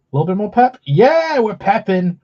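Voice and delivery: high, loud hyped cheer; excited. Real, not acted. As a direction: pump up the pep. Hyped reaction.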